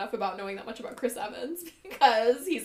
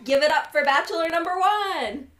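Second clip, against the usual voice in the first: game show host; 5 semitones higher than usual. Acted. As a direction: announce the bachelor like a TV host.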